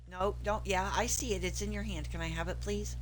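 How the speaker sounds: loud, insistent pleading to the dog. Real, not acted. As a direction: plead loudly with the pet.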